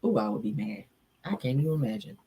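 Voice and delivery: ordinary talk, nothing marked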